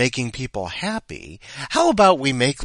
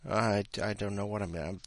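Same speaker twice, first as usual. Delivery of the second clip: ordinary talk, nothing marked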